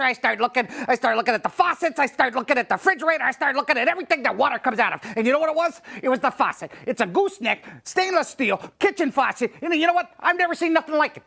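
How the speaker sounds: ordinary talk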